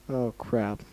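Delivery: quiet, sickened mumble; queasy mutter. Real, not acted. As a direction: mutter like you feel sick. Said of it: "I'm gonna puke".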